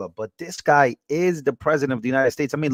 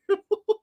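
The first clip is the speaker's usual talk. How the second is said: laugh. Real, not acted. Streamer laughing at the clip.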